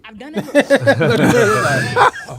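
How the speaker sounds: loud laughing blurt. Real, not acted.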